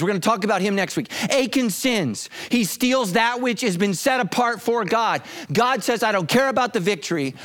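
high, urgent sermon delivery; impassioned preaching. Real, not acted. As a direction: preach it high and hard, stretching key words. Preaches at full force.

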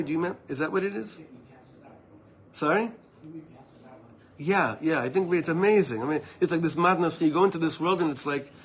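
ordinary delivery

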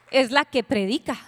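ordinary talk

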